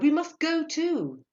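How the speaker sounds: loud, high, drawn-out call; exuberant, slow shout; eager cry. A narrator's reading. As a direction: cry out to join in. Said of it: "he cried".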